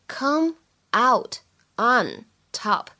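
"come out on top" one word at a time; 逐词分读. Shown as a demonstration. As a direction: separate each word of "come out on top" with a clear pause. Four words cited one by one. ("come out on top"一词一顿)

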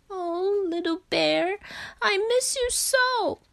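high, plaintive acted wail; weeping. A narrator's reading. Frog lies grieving for Bear at night, tearful. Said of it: "he wept".

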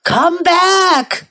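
calling out. A narrator's reading. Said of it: "cried the little old man".

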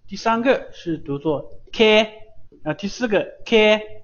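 提高音调示范K; model ke. Shown as a demonstration. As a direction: drill K for the class. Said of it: "发这个音的时候".

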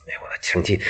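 plain reading voice

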